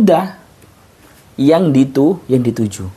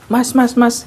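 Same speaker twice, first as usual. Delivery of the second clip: high calls of mas; mock call, higher; calling out to a stranger; 9 semitones higher than usual. Shown as a demonstration. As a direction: call to passers-by, pitched up.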